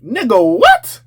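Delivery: incredulous high squeak on what; mock disbelief, voice jumping up on what; shocked what. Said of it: "I'm like".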